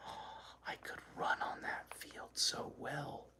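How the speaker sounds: breathy wistful hush; dreamy whisper. A narrator's reading. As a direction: whisper it softly, longing. Dog's wish voiced in whisper.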